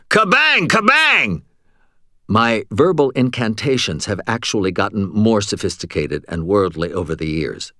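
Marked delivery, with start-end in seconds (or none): 0.1-1.4 much higher, louder screaming of the spell word; screamed spell. A narrator's reading. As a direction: scream it with all your will. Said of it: "gathered up my will and screamed".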